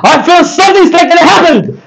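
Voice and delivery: high, hushed-dramatic prophetic build; mock hype preacher. Mocking hype preachers with a high, dramatic prophecy. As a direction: lightly play a preacher teasing a miracle. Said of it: "kanilang preacher".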